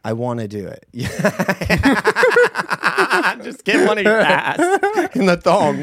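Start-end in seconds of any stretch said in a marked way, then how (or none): 1.1-3.4 loud high burst of laughter; big laugh. Real, not acted. Laughing at thong idea. 3.4-5.8 laughing. Real, not acted. Laughing at thong idea.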